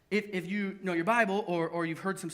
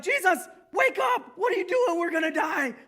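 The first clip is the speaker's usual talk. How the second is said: high, loud, frantic cry to wake Jesus; panicked shout; 11 semitones higher, 8 dB louder than usual. Retold or imitated. Disciples screaming at sleeping Jesus, terrified shout. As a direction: yell it like you're sure you'll drown.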